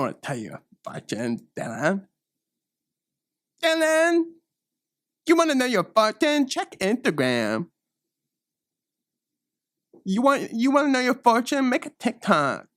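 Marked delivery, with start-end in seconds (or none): 3.6-7.7 squealed taunt. Acted. Teen taunting customer Dylan in a very high squeal. 10.0-12.7 mock them from up high; high mocking taunt. Taunting again.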